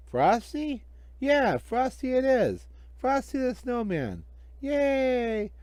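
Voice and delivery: put-on high, eager kid voices; little kids delighted by the first snow; excited children.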